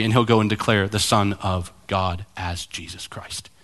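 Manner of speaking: hushed whisper of a secret plan; breathy confidential whisper. Acted. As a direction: whisper it like a promise. God revealing his plan.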